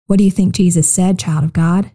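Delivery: ordinary delivery, nothing marked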